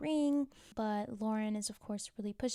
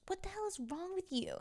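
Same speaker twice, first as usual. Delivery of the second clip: pitched up, softer, indignant; higher mock outrage; 9 semitones higher, 5 dB quieter than usual. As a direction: throw it back at him, pitched high. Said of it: "Kaylee turns around at Austin and says".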